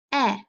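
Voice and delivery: "eh" said alone as a short clipped e; isolated sound. Shown as a demonstration. Teacher isolating short e for kids, clear and brief. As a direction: give just the "eh" sound. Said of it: "Digraph E A".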